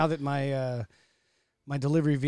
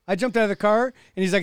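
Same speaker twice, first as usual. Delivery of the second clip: usual speaking voice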